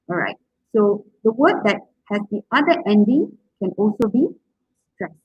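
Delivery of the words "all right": ordinary delivery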